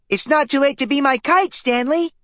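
put-on very high, cheeky kid voice; little brother. A narrator's reading. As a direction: tease him like a gleeful little brother. Little brother Arthur teasing.

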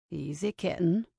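low murmured man's voice; murmuring man's voice. A narrator's reading. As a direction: murmur it low, close to the ear. Kincaid murmurs teasingly to Ava.

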